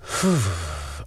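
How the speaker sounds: a long weary exhale before speaking; steady yourself with a slow real sigh; heavy sigh. Real, not acted. Memoirist gathering herself after her reading, sighing. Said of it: "trying to gather my resilience".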